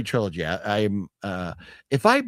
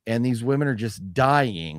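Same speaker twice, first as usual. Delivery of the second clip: usual speaking voice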